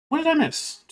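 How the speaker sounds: usual speaking voice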